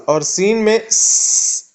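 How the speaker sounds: س held as a long slow hiss; slow hiss demo. Shown as a demonstration. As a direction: stretch the س slowly. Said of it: "اس طریقے سے آواز".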